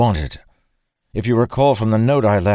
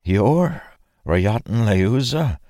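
high-pitched, disbelieving question; halting astonishment, voice lifted; utterly astonished; 5 semitones higher, slower than usual. A narrator's reading. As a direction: ask it stunned, pausing before the name. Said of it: "in utter astonishment".

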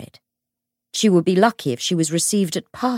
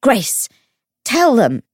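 higher, louder indignant demand; spluttering; 6 semitones higher, 7 dB louder, slower than usual. A narrator's reading. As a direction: splutter it out as an urgent order. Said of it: "Tatiana was spluttering".

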